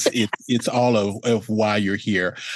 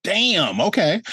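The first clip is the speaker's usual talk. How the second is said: impressed; 9 semitones higher, slower than usual. Retold or imitated. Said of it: "And I'm like".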